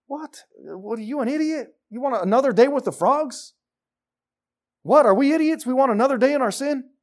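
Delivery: high-pitched, disbelieving questions; incredulous, voice shooting up; mock incredulous. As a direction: act appalled at such idiocy. Mock disbelief at Pharaoh's request.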